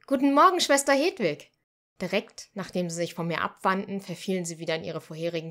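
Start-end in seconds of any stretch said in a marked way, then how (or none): 0.1-1.5 bright, much higher and louder greeting; cheerful chorus greeting. Acted. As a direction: greet the sister all together. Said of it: "fast im Chor begrüßten".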